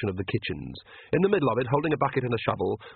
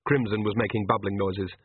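ordinary delivery

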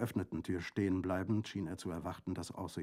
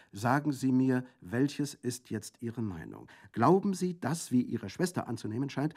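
voiced prefect, pressing; 7 semitones higher, 10 dB louder than usual. A narrator's reading. Prefect questions Orso pointedly.

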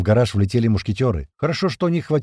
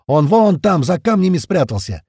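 high-pitched, loud alert; shouting; 7 semitones higher, 5 dB louder than usual. A narrator's reading. Crewman pointing out a hidden target, shouting. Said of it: "снова закричал Упырь".

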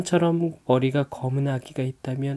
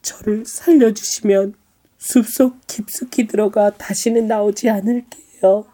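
high pleading princess voice; tearful princess; 9 semitones higher, 4 dB louder than usual. Acted. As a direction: beg high and loud for your life. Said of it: "눈물을 흘리며 말했어요".

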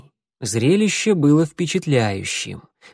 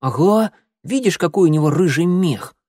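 kitten voice; 6 semitones higher, 3 dB louder than usual. A narrator's reading. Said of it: "мяукнул в ответ чёрный".

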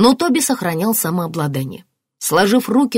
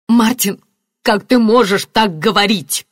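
raised, shocked reproach; shushing rebuke; 5 semitones higher, 5 dB louder than usual. A narrator's reading. Marianna to Martin, reproachful shushing. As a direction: scold him sharply, higher and louder. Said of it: "шикнула на него Марианна".

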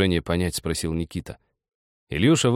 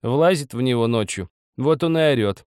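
higher-pitched, wry; amused old woman; 6 semitones higher, 3 dB louder than usual. A narrator's reading. Old woman explains husband's night shouts, wryly. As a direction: tell it with a smirk. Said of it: "Старуха усмехнулась".

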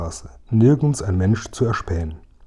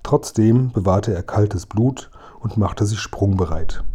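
ordinary delivery

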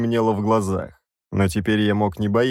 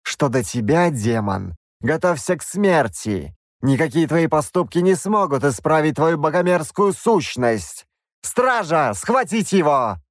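higher, preaching bishop voice; stern bishop; 6 semitones higher than usual. A narrator's reading. Bishop condemns the princess for accepting help, sternly. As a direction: lecture them like a zealous cleric. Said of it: "bishop condemns the demon".

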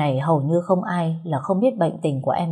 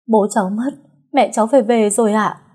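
distressed reply; 4 semitones higher, 5 dB louder than usual. Acted. Distraught girl answers the doctor.

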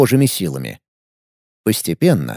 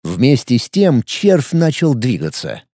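ordinary delivery, nothing marked

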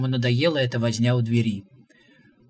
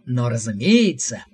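mocking; 3 semitones lower, 4 dB louder than usual. A narrator's reading. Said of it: "улыбка пророка стала откровенно глумливой".

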